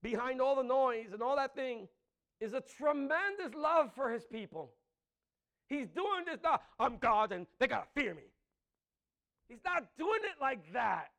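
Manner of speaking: loud, rising preacher's declamation; preaching at full force. Acted. Preacher proclaiming God's love to the congregation, full force.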